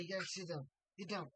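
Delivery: ordinary talk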